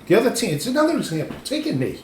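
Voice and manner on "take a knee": exasperated plea. Retold or imitated. Imploring the other team.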